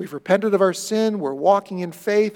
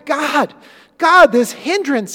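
raised, slow pleading cry to God; pleading prayer; 8 semitones higher, 9 dB louder, slower than usual. Acted. Preacher voicing Paul, loud slow plea.

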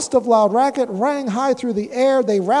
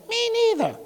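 much higher squeaky little joey voice; young kangaroo; 12 semitones higher, faster than usual. Acted. Joey in mother's pouch chiming in, much higher. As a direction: pipe it up like a tiny joey. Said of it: "kangaroo in her pouch said".

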